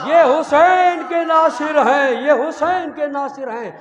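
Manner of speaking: loud, high, slow drawn-out declaration; slow emphatic preaching. Acted. Preacher repeating the refrain to mourners, slow and loud. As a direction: hammer the refrain home slowly.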